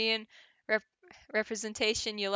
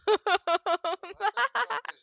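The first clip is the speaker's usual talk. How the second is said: high mocking cackle; villain laugh; 11 semitones higher, 3 dB louder than usual. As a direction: cackle theatrically at the lawyer. Game villain laugh after taunt.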